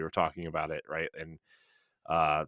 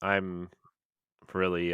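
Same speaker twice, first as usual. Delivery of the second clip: normal talking voice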